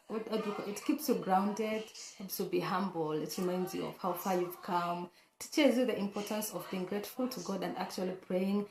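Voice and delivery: normal talking voice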